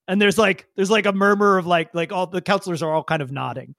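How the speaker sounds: usual speaking voice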